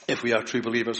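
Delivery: normal talking voice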